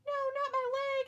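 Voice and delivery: very high mock pleading; put-on high voice begging for mercy; whiny wrestler voice. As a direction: plead in a silly squeal. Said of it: "And he's like".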